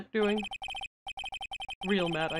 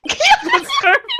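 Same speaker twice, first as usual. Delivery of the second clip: laughing; 8 dB louder, slower than usual. Real, not acted. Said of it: "laughing praise".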